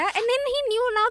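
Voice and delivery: ordinary talk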